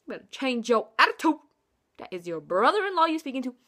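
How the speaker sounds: a playful mock-stern scolding; sassy scolding. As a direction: tell her off with attitude. Scolding the sister.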